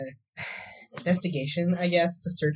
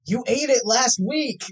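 higher, slow and drawn-out comeback; exasperated retort; 7 semitones higher, slower than usual. Acted. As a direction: argue back, stretching each word. Acted popsicle quarrel, answering back.

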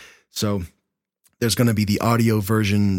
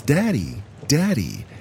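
put-on higher, childlike repeated call; toddler calling Daddy; 6 semitones higher than usual. Two-year-old calling for her dad, high and childlike.

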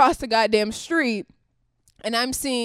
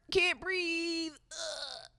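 mock crying; 6 semitones higher, 11 dB quieter, much slower than usual. Mocking a protester's plea.

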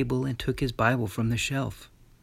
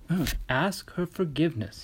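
firm; 5 semitones higher than usual. A narrator's reading. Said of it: "he said firmly".

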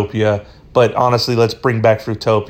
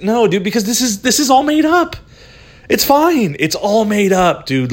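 a lightly voiced, higher reassuring buddy; mock reply; 9 semitones higher than usual. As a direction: talk it off lightly in a raised voice. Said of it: "It's like".

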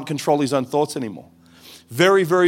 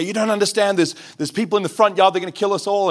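higher, urgent retelling of a frightened warning; paranoid father; 6 semitones higher than usual. Retold or imitated. Father in a paranoid episode warns his family, high and urgent. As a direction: voice the father's panic, pitched up but not full force.

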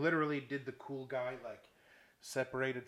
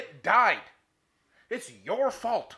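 loud, high exasperated outburst; indignant blame; 7 semitones higher, 10 dB louder, much slower than usual. Retold or imitated. Golfer blaming the marshal for the dead cart, indignant. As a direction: throw the blame back, punching each phrase. Angry retort to marshal retold.

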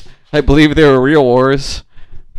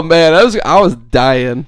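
ordinary talk, nothing marked

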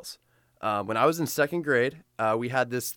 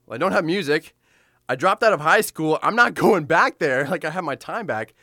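freaking out; 5 semitones higher, 5 dB louder than usual. Retold or imitated. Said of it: "I'm literally freaking out".

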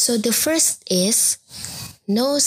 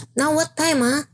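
Singlish question, pitched higher with a lilting "ah"; Singlish accent; 6 semitones higher than usual. Shown as a demonstration. Teacher demonstrates a Singaporean asking the time, in a Singlish accent. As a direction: ask like a Singaporean local, ending on a light "ah". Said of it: "Singaporeans would say".

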